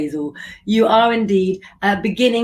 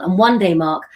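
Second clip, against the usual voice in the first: normal talking voice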